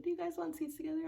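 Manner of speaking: polite attendant voice; chirpy flight attendant. Retold or imitated. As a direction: lift it up like a cheerful attendant. Flight attendant's bright question.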